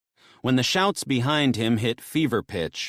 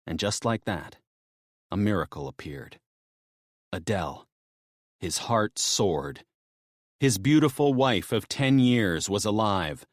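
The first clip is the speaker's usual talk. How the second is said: usual speaking voice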